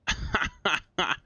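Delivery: short high laugh. Real, not acted. Laughs after disbelief.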